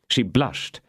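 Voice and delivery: plain reading voice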